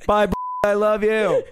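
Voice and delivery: put-on high, loud drunk farewell; drunken sing-song goodbye. Drunk woman yelling goodbye to her friend outside the bar. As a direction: call it out like a sloppy happy drunk.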